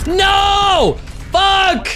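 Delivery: screaming in rage, high and loud; furious shout. Real, not acted. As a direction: yell it out in frustration. Killed after a long hunt.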